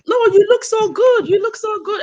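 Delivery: very high-pitched imitation of coaxing relatives; mimicked insistent reassurance. Relatives to crying speaker about bare hair, high mock reassurance.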